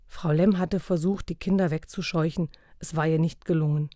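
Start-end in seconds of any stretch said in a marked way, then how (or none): none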